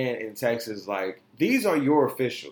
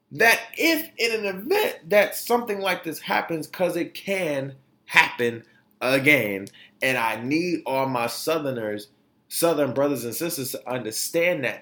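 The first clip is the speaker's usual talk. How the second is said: ordinary talk, nothing marked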